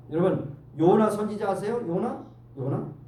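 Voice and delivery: ordinary talk, nothing marked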